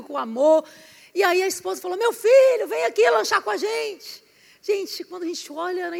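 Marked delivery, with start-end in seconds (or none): 2.0-4.2 put-on loud high call to the boy; mother calling her son to come eat.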